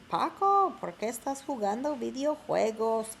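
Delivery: high, loud call of an annoyed mother; mother yelling at her son over video games; mom yelling. Acted. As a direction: yell for Paco like a fed-up mom. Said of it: "la mamá de Paco is yelling".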